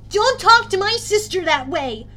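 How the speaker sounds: put-on high, loud boy standing up for his sister; quivering voice. A narrator's reading. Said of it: "His voice quivered".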